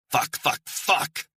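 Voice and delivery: sharp rising burst of swearing; angry cursing. Acted. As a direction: spit the curses out. Character swears at bad news.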